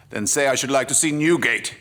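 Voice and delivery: high, loud, proud retort; defiant bravado. Acted. Casanova refusing the constable's bribe, loudly defiant. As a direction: toss it off with swaggering defiance.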